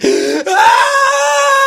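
high, loud, mock sobbing wail; snotty crying. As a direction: wail like a crying twelve-year-old. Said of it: "snotty crying".

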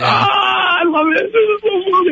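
high laughing squeals. Real, not acted. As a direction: laugh through the words. Laughing at the helium voice.

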